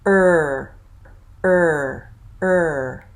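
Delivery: er with lips tight and tongue curled; tight er sound. Shown as a demonstration. Teacher modelling the R-colored er vowel. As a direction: hold a tense, curled r-colored er. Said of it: "my tongue is very tight".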